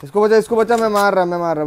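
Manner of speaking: panicking gamer. Real, not acted. Frantic call to save teammate.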